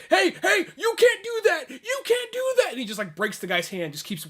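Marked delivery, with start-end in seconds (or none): 0.1-2.7 high, loud mock shouting; powerless protest. Retelling a powerless guard confronting RoboCop, high frantic shouting. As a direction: yell an indignant, useless objection, pitched way up. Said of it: "some guy that has no power over him is like".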